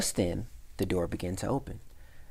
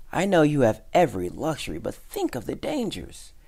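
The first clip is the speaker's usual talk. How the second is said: high, urgent pleading; worked-up warning to a friend; agitated protest; 7 semitones higher, 5 dB louder than usual. A narrator's reading. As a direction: argue it out with pitch raised. Frightened mouse protesting to friend.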